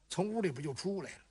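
plain reading voice

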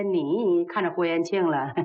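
ordinary delivery

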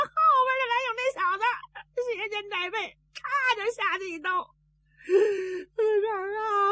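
high-pitched tearful account; crying report. A narrator's reading. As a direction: wail the bad news in a high, sobbing voice. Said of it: "你别哭". (尖着嗓子哭诉噩耗)

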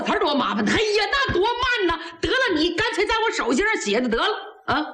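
high-pitched, hurried impatience; impatient urging. A narrator's reading. General hurries the emperor to write on his palm, impatiently. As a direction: rush him along, no time to waste.

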